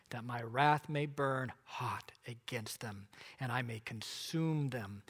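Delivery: low, soft and slow, sinking to a whisper on the wrath; a low, slow, hushed voice of divine anger; God's wrathful voice. Acted. God telling Moses of his wrath, low and hushed.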